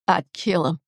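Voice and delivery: even, understated threat; unemotional, matter-of-fact delivery. A narrator's reading. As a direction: state it as simple fact. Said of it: "very matter-of-fact tone".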